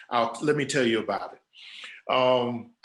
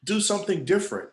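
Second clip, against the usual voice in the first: mock scream; 8 semitones higher, faster than usual. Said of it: "I keep screaming at the young people".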